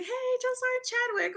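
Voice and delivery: put-on high-pitched, delighted hail to an old sergeant; excited greeting. Retold happy greeting on meeting sergeant.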